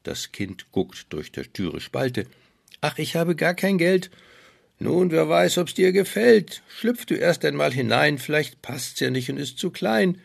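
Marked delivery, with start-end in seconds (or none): 2.8-4.1 put-on young girl voice. 4.8-10.2 put-on sly, high old peddler woman; evil queen. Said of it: "So sprach die Königin gerissen".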